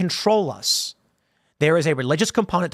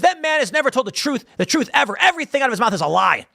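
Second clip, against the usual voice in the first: much higher, imitating an outraged poker player; mock outraged; 7 semitones higher than usual. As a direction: play the guy losing it over a Trump vote. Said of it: "this guy lost it".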